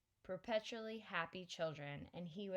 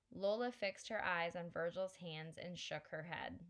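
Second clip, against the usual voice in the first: ordinary delivery, nothing marked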